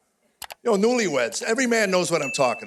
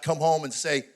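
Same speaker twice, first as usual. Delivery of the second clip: usual speaking voice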